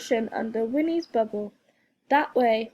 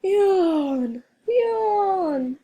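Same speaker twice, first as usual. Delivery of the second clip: higher, louder yawning words; big yawn; 7 semitones higher, 5 dB louder than usual. A narrator's reading. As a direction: stretch it out in a yawn. Everyone is tired.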